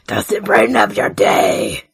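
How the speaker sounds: very low demon growl; spooky demon voice. Pretend. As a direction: make the line spooky and low. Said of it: "I'm trying to make it spooky".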